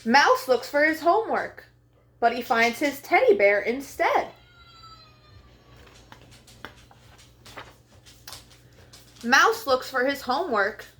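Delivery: bright, high storybook read-aloud; lively picture book voice for young listeners; reading to children. Acted. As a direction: read it like story time for little kids. Reads picture book to kids.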